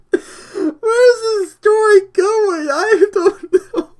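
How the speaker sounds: loud, high, squealing through laughter; laughing hard. Real, not acted. Reader baffled by own rambling story, laughing hard. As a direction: wail it slowly through real laughter.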